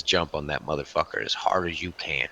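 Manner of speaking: low, cold command from a lightly voiced thug; Louis's menacing order. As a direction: mutter it like the hulking enforcer giving orders. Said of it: "he looks at me and he says".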